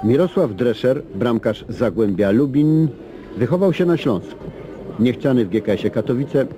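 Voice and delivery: ordinary talk, nothing marked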